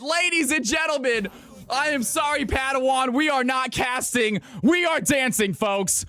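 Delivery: rally everyone to celebrate; hype shouting. Real, not acted. Hyping chat to dance.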